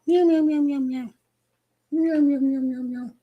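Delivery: very high cat meows; meowing. Pretend.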